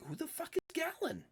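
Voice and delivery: high-pitched confused question; squeaky NPC voice. Acted. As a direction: ask it in the little guy's high voice. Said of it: "He's like".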